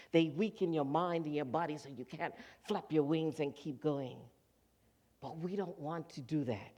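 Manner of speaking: ordinary talk, nothing marked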